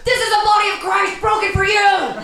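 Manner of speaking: mock zealot yell, high and loud. Gang kids yelling at little atheist, yelling. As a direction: yell it like a chanting bully.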